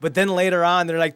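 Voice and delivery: ordinary delivery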